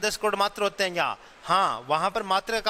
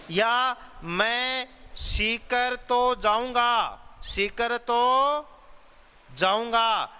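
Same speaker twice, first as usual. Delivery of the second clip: सीकर तो drawn out very slowly and higher; stress on Sikar तो; 4 semitones higher, much slower than usual. Shown as a demonstration. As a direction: lean on Sikar तो, slowly and firmly. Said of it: "Sikar पर क्या किया गया है? बल प्रदान". (सीकर तो पर ज़ोर, बहुत धीरे)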